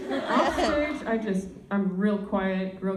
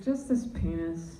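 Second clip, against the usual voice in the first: low dude voice; 7 semitones lower, 3 dB quieter than usual. Retold or imitated. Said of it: "I'm just like".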